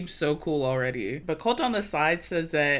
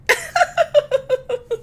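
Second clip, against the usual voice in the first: shrill nervous giggle, loud and high; 6 dB louder than usual. Retold or imitated. Vlogger mimics Courtney giggling at Chad, high girlish giggle. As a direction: giggle like a shy girl. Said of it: "was giggling".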